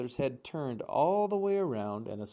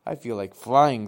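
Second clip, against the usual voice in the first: excited; 12 dB louder than usual. Acted. Gosling eager to fly.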